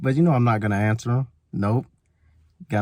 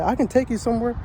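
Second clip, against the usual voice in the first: higher stranger voice; 9 semitones higher than usual. Retold or imitated. Stranger offers a ride.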